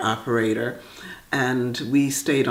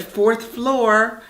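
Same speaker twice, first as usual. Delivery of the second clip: put-on little girl asking brightly, pitched up; bright eager child; 8 semitones higher than usual. Girl asks elevator operator for her floor, bright and eager. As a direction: chirp it like an excited seven-year-old. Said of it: "with my brightest smile".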